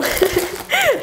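short high-pitched giggle; a nervous little laugh. Real, not acted. Worried the package broke, she gives a high nervous giggle.

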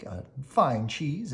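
ordinary delivery

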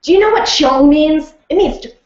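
imitating her Singaporean dialect coach, lively; Singaporean accent. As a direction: play the Singaporean coach, bold. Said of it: "she was Singaporean, and she was like".

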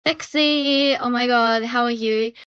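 ordinary talk, nothing marked

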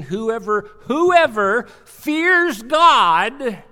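normal talking voice

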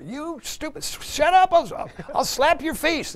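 high, loud hammed-up family squabble; mock angry fighting. Family fighting in the car before church, mock yelling. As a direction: yell threats in a high acted fury. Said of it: "they're fighting in the car".

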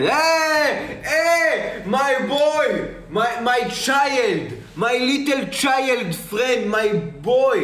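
high, drawn-out excited greeting; excited character voice. Acted. Streamer thanking a donor, high excited character voice. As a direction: call out like a thrilled old parent finding his boy.

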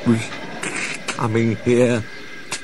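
slow, faltering frightened telling; shaky voice. A narrator's reading. Toby telling Thomas about the ghost, slow and shaky. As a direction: stumble over the words in fear. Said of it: "said Toby in a shaky voice".

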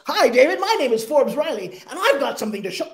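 loud, hammed-up used car salesman opener; cheesy pitch voice. Shown as a demonstration. Mocking how bad pitchers greet an investor, loud cheesy salesman voice. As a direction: put on the fake salesman grin and push it. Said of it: "Pitch voice".

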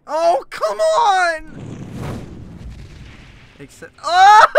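loud, high cry breaking up; whine, then wail in fright; long quavering howl of protest; distressed wail. Real, not acted. Game scare.

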